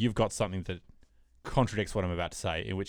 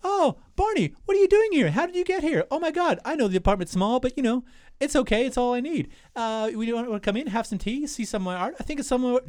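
much higher, louder mock woman's voice; imitating Lily; 4 dB louder than usual. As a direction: play the girlfriend in a high put-on voice. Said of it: "pretending to be Lily".

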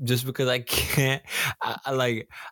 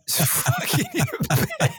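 laughing. Real, not acted. Laughing disbelief.

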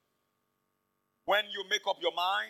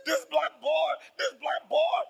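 higher, louder whining complaint; tattling child; 7 semitones higher, 4 dB louder, slower than usual. Retold or imitated. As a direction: whine it to the teacher like a kid. Children telling on him to teacher.

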